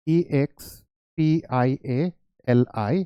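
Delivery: E x P I A L I spelled letter by letter, slow and soft; spelling out letters. Shown as a demonstration. Teacher spelling a word part for students, slow and soft. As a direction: spell each letter slowly. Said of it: "आप ऐसे लिख सकते थे".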